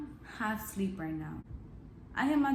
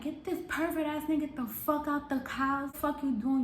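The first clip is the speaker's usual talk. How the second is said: high-pitched retold demand to throw him out; acted-out angry outburst, pitched up; outraged order; 6 semitones higher than usual.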